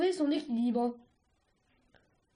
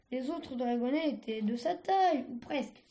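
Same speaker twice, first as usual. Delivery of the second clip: ordinary delivery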